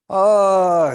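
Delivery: high, loud startled gasp of a word. Acted. Old man startled by a voice, loud surprised oh. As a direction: jump at the sudden voice.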